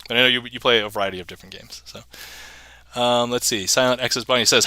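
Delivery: ordinary talk, nothing marked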